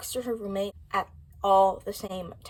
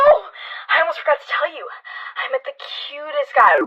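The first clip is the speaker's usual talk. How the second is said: high, loud gushing that drops to a thrilled whisper; girlish gossip, whispered at the end; gossipy excitement; 6 semitones higher, 8 dB louder than usual. Acted. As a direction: spill the secret to your roommate. Gossiping monologue line.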